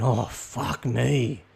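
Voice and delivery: put-on stretched, weary kid's complaint; slow fed-up groan. Shown as a demonstration. Bored kid outside Woolies, drawn-out weary groan. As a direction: let each word sag slowly. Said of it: "this is the equivalent of a kid saying".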